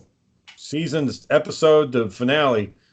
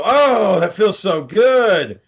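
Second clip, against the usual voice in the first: high, drawn-out mock moan of relief; milk the relief, slow and high; hammed-up blissful sigh of words; exaggerated relief; 7 semitones higher, 4 dB louder, much slower than usual. Retold show character in relief.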